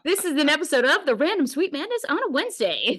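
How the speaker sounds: high, fast and grinning, like a radio ident; hammed-up show intro, quick and bright; mock announcer. Host jokingly announces the mashed-up show name, mock announcer.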